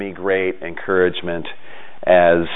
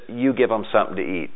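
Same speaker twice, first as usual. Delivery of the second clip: softly, a gentle calm command; a hushed, matter-of-fact reply; quiet Jesus voice; 8 dB quieter, faster than usual. Retold or imitated. Jesus answers the worried disciples, quiet and calm.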